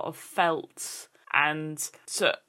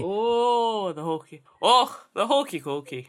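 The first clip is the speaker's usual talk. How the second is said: mock Scottish accent; 2 semitones higher, 3 dB louder than usual. Copying the Scottish hokey cokey.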